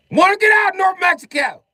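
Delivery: very high, loud comic shout; hammed-up cry of complaint; exasperated mock yell. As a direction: holler to be let out. Mock complaint about living there.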